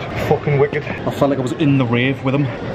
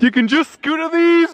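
higher, a bit louder, amazed; excited; 12 semitones higher, 3 dB louder than usual. Real, not acted. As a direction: pitch it up in delight. Marvels at scooters on street.

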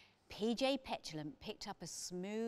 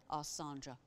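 ordinary delivery, nothing marked

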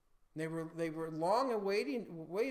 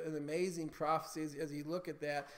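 ordinary talk

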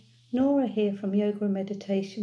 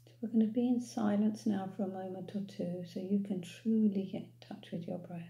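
calm, unhurried meditation voice with long pauses; guided meditation. Real, not acted. Guide leading listeners to follow their breath, calm meditation voice. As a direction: ease them gently into noticing the breath.

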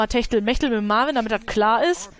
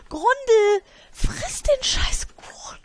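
much higher, slow whispered command; slow high whispered rage; squeaky hissed order; 12 semitones higher, slower than usual. Real, not acted. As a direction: hiss the order in a tiny voice. Ordering sim to eat cake.

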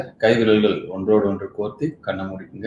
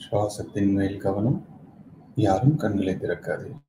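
soft, measured meditation guidance; quiet meditation-coach voice with long silences between instructions; guided meditation; 7 dB quieter than usual. Acted.

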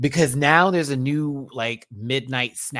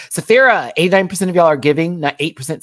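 excited shout-out; 7 semitones higher, 11 dB louder, faster than usual. Real, not acted. Reading chat poll aloud, loud.

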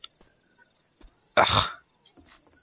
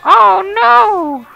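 much higher, louder panicked oh no; real alarmed cry; 11 dB louder than usual. Real, not acted. Gamer cries out as the horde closes in. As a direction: yelp as it goes wrong. Sudden danger in game.

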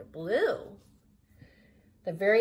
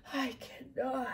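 voiced, weary refusal of the old engine; tired old engine; 5 dB quieter than usual. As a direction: say it plainly tired, not whispered. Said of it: "I'm making myself tired".

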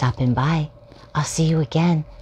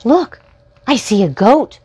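higher, louder excited call; excited spotting; 10 semitones higher, 9 dB louder than usual. Acted. Storyteller spotting the goat again, excited higher call. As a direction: point it out like a thrilled kid.

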